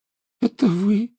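put-on high, sulky ghost girl voice; sulky schoolgirl ghost, high-pitched; gloomy ghost girl. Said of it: "хмуро произнёс призрак".

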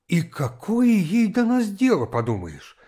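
ordinary delivery, nothing marked

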